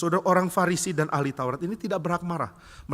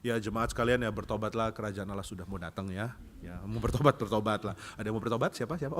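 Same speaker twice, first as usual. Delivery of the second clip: soft, low, half-hearted sermon; careless preaching; 4 semitones lower, 6 dB quieter than usual. Acted. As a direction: preach as if you don't care. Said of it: "Dia khotbahnya pun seenaknya".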